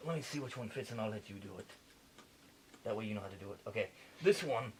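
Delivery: lightly put-on French accent playing a surgeon; a French surgeon narrating the operation, a hint of French accent; fake French accent. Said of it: "going back to the accent".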